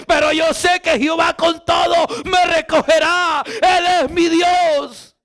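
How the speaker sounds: shouted, high psalm proclamation, slowing on the last line; proclaimed psalm. Acted. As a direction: cry out David's psalm to God.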